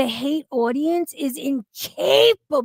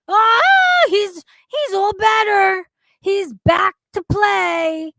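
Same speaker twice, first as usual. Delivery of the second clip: mock sarcastic cheer; 5 semitones higher, 5 dB louder than usual. Mocking Zachary's sudden recovery.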